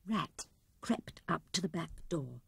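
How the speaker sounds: usual speaking voice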